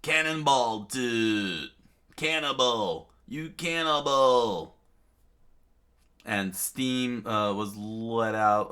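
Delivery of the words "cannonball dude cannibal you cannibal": loud, slow accusing yell; comic loud yell; shouting. Acted.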